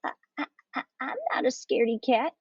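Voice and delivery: breathy stutter, then high quick denial; stammer, then protest in a rush; stammering hiss. A narrator's reading. Gatito answers the chiding fox, stammering hiss. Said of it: "hissed the gatito".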